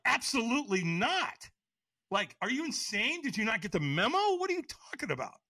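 high-pitched indignant retort; agitated high tone. Retold or imitated. Refusing the guy's invitation to walk, agitated high tone. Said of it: "I said it to him with a high tone".

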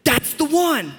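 high-pitched, hammed-up infatuated exclamation; smitten teenager. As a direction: act out a lovestruck teen, voice jumping high. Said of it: "you're like".

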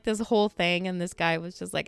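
ordinary talk, nothing marked

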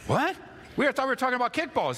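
squeaky high disbelief, words rushing out fast; baffled kid. Retold or imitated. Kid baffled by the insult, disbelieving high voice. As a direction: sound stunned the talk turned personal.

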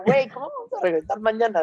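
incredulous. Retold or imitated. Retelling her own disbelief.

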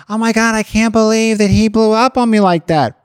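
put-on overdramatic girlfriend complaint; complaining girlfriend. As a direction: gush it out in mock disbelief. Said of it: "There's not always time like".

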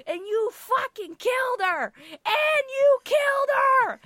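high, drawn-out screaming accusation; imitated yelling. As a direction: holler it slowly from the back of the store. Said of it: "he's yelling at the owner".

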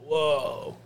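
high amazed bro; impressed bro reaction; frat bro. Retold or imitated. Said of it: "I was like".

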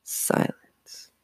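usual speaking voice

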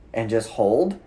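ordinary talk, nothing marked

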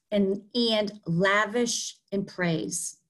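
slowly repeated for the listener; slow careful repeat. Shown as a demonstration. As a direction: say it slowly and clearly. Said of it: "Can you say that one more time".

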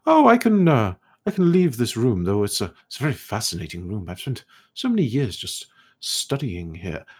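put-on high, airy voice of a curious old elf scholar; scholarly elf ghost. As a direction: play the puzzled, polite ghost scholar, pitched high. Ghost speaks.

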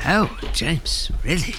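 high hushed nervous query; a high, fearful hush. Acted. Nervous hush to James about the door.

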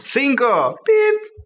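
high beep. Pretend. Mock high beep, joking.

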